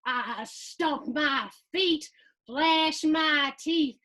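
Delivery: slow, building to a loud roar; roaring dragon. Acted. Boy playing dragon in the hallway, building to a loud roar.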